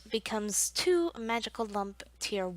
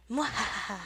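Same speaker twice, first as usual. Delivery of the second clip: mad scientist; 2 semitones higher than usual. Pretend. Evil laugh after leaving sugarcane.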